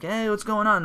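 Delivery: "Hey, what's going on?" lifted high in a playful, innocent tone; mock-innocent greeting said well above normal pitch. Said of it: "He's like".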